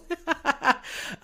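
high, loud burst of embarrassed laughter; real sheepish laugh. Real, not acted. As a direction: laugh it off, caught out. Laughing after skipping a question.

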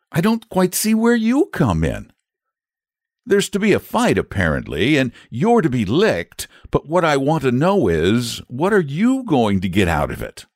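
put-on high-pitched, mildly indignant boy; jealous boy. A narrator's reading. Boy questions the dragon for his friend, a little jealous. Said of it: "a little jealous on behalf".

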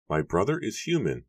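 high, gentle character voice; soft-spoken Matthew. A narrator's reading. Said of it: "Matthew said softly".

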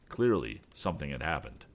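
ordinary delivery, nothing marked

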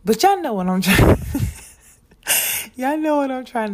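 loud, high burst of laughter through the words; laughing. Real, not acted. Podcaster to listeners after botching a word, laughing. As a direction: crack up at your own slip.

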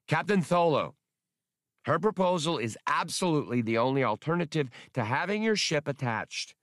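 high, firm, lisping creature voice; firm squeaky lisp. A narrator's reading. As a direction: stand your ground, polite but unbending. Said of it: "remained unexpectedly firm".

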